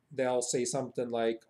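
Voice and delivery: normal talking voice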